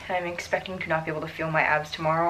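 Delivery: usual speaking voice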